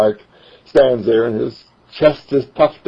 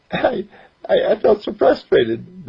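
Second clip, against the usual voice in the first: put-on higher, young boy's voice; 11 semitones higher than usual. Boy telling storyteller he felt frustrated, young voice. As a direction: speak as a frustrated ten-year-old.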